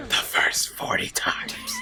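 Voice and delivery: slow, airy fake whisper; breathy retort. Teacher lets the kid know, slow breathy whisper. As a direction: drag it out, all breath. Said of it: "Then she finally goes".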